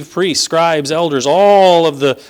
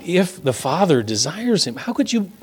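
ordinary talk

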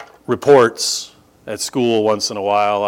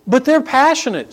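mock objection; 5 dB louder than usual. Mocking the objection.

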